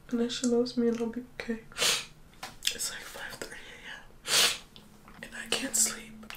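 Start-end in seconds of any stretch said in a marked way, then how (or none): 2.7-6.3 slow, hushed whisper between sobs; real teary whisper. Real, not acted. Filming herself crying before dawn, teary whisper. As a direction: keep it a secret whisper, barely voiced. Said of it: "I don't know why I'm whispering".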